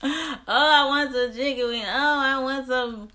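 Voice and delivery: high, loud whiny wail for food; mock whining. Retelling cravings while fasting, hammed-up whining for chicken wings. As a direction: whine like a starving kid begging for snacks. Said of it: "whining and about stuff".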